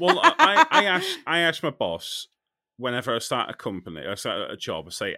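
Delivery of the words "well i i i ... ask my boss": high laughing burst, then laughing words. Real, not acted. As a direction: laugh through the start of the sentence. Laughing while explaining.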